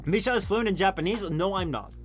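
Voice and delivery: ordinary talk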